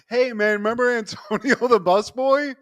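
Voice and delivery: high, loud acted alarm; excited announcement. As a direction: burst in with bombshell news. Kramer bursts in with shocking news.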